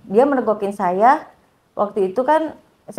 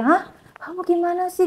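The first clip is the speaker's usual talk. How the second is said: high, softer voice of disbelief; retold shocked reaction; 8 semitones higher, 4 dB quieter than usual. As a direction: imitate a friend taken aback. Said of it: "Kaget dong teman saya". (menirukan teman yang kaget, nada tinggi)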